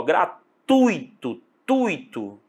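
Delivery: "Gratuito, tuito" drawn out, the U dominant; slow "Gratuito", then "tuito" repeated with U winning; stress on U. Shown as a demonstration. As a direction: lean long on TU in "Gratuito, tuito". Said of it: "O U ainda ganha".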